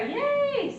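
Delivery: high squeaky mock cheer. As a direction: cheer like it's a treat.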